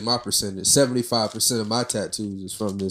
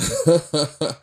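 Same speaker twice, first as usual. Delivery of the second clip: laugh; 6 semitones higher, 4 dB louder than usual. Real, not acted. Laughing at own impression.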